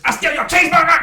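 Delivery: high, loud cartoonish crackhead squeal. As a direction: screech it like a crazed street thief. Mocking crackheads.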